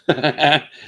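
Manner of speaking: a sudden high, loud laugh; burst of laughter. Real, not acted. Laughing at his own story of yelling in his sleep. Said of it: "That's pretty funny".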